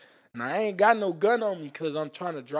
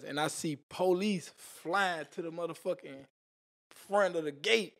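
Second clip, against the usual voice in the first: normal talking voice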